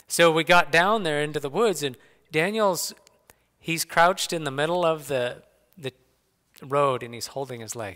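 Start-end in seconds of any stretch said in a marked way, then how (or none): none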